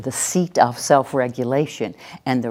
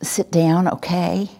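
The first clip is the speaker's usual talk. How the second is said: tentative command ending in a question; passive; 3 semitones higher, slower than usual. Shown as a demonstration. Trainer demonstrating a passive order to the class, tentative. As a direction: rise on "okay" as if asking permission. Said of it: "And a passive voice is".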